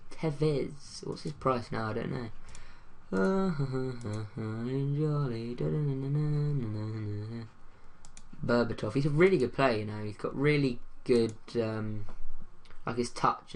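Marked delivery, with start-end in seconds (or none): 2.3-8.4 long, drawn-out sing-song doodling noise; wavering laugh that runs into a hummed tune; tuneful wordless vocalising. Real, not acted. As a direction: idly sing nonsense syllables to yourself. Idle tuneful noise while browsing.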